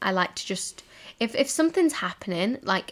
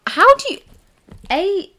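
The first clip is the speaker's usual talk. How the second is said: exasperated; 11 semitones higher, 8 dB louder than usual. Real, not acted. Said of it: "frustrated outburst".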